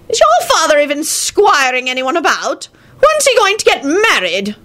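put-on high-pitched scolding old man; old grandfather's high, indignant voice; crotchety old wizard. Old wizard interrogates the boy.

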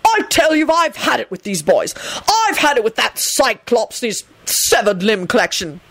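high furious tirade, building; fed-up screaming, near cracking; screamed rant. A narrator's reading. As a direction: rant at them all, pitch climbing to a screech. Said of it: "he screamed at Dumbledore".

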